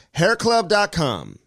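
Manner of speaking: slow, drawn-out sponsor tagline; slow, high announcer read of the slogan. Acted. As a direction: sell it like a radio spot.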